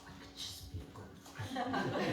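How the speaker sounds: slow, breathless half-laughed whisper; laughing whisper. Retold or imitated. Songwriter wishing she could forget like a goldfish, laughing whisper. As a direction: giggle the wish out under your breath.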